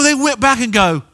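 ordinary talk, nothing marked

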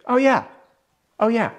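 high, light sympathetic nod; empathetic agreement. Shown as a demonstration. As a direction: agree gently, showing you understand. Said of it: "Then we've got an agreement".

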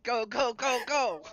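high, loud cheering on; excited urging. Real, not acted. Urging a friend to do the captain's log, excited chant. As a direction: egg them on loudly.

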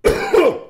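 one sharp high cough; real cough. Real, not acted. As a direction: clear the throat with a cough. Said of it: "Give me a second".

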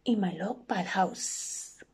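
slow, hushed snake with drawn-out s; sly inviting snake. Acted. Snake inviting the little mouse to a feast, hushed and drawn-out. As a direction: lure them in softly as the snake.

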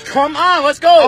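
barking orders to get out; heated shouting. Real, not acted. Officer pulling driver from car.

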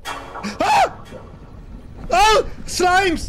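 high shriek then loud cry; real fright; startled scream. Real, not acted. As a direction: yelp at the slime. Monster appears suddenly.

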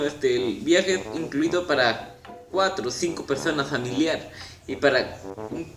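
ordinary talk

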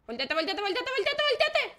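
rapid repeats climbing to a high shout; frantic urging. Real, not acted. Urging turn in game panic. (súplica frenética cada vez más aguda)

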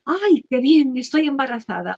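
high, loud burst of joy at the news; happy exclamation. Retold or imitated. Mother finding out she is pregnant, delighted and loud. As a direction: squeal it out like a thrilled new mother. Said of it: "si la madre en ese momento dice".

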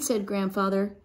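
plain reading voice

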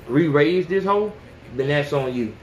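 ordinary delivery

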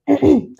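short, loud throat clear. Real, not acted. Vlogger whose voice is starting to go, clearing the throat. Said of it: "आवाज़ ख़राब होने लगी है".

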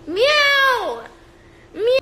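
loud high cat meow. Retold or imitated. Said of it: "He goes like this".

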